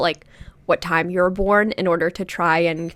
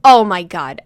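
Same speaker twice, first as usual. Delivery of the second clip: mock exasperation, louder and halting; acted-out annoyed sputter; annoyed mom; 6 dB louder than usual. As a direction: imitate a mom at a loss, in disbelief. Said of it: "And she got so annoyed".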